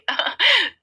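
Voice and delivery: high nervous laugh falling away; high nervous chuckle that drops off. Acted. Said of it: "घबरा जाती है और हकलाने लगती है".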